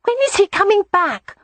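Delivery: put-on high, pleading child voice; little boy wanting his playmate back; complaining little boy. A narrator's reading. Boy complains about absent cousin.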